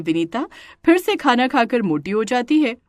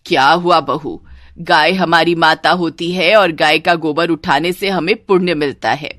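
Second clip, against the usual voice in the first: lower, firm mother-in-law voice; preachy mother-in-law; 3 semitones lower, 4 dB louder than usual. Acted. Mother-in-law telling the bride of merit, preachy. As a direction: lecture the bride in a low register.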